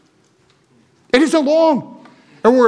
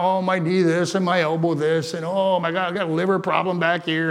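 mock old-timer whining; 4 semitones lower, 7 dB quieter than usual. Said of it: "we talk".

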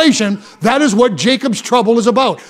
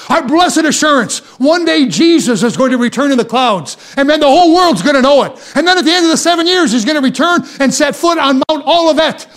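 raised, driving sermon delivery; forceful preaching; 3 semitones higher than usual. Real, not acted. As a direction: preach it with rising fervour, rolling from phrase to phrase.

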